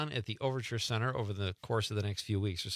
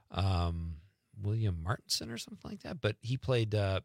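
ordinary delivery

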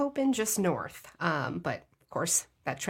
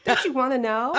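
laughing; 5 semitones higher, 6 dB louder than usual. Real, not acted. Teasing, laughing.

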